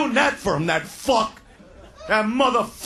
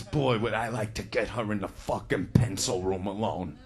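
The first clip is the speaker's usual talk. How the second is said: inner thought voiced; 8 dB quieter than usual.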